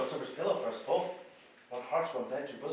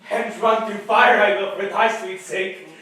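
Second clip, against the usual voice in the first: very loud, slower and higher; passionate declaration; 5 semitones higher, 14 dB louder, slower than usual. Acted. Enchanted Lysander waking to Helena, loud passionate vow. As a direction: burst out with lovestruck fervour.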